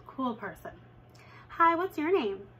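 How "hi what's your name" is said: bright high friendly hello, louder; cheerful kid greeting. Retold or imitated. Cool kid greeting the new girl, high and bright.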